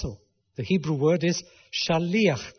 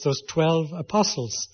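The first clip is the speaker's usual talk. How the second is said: ordinary delivery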